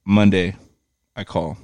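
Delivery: ordinary talk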